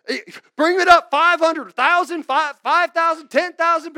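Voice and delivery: loud, high hammed-up preacher calling for money; mock offering call. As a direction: bark out the amounts like an auctioneer preacher.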